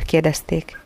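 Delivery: ordinary delivery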